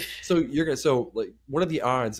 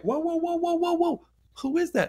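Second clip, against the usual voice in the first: a much higher, alarmed chorus of whoas; startled onlookers. Retold or imitated. Imitating startled fans at camp, high alarmed whoas. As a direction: play the crowd, startled and alarmed. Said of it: "And they're all like".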